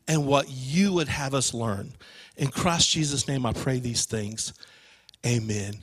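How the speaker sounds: low, soft prayer winding down; closing prayer. Real, not acted. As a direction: bring a prayer quietly to its amen. Closes the prayer.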